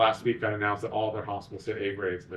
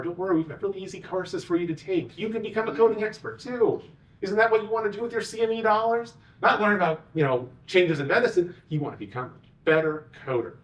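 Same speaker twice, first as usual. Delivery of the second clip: mock sales pitch; 8 semitones higher than usual. Mocking vendors' sales pitch.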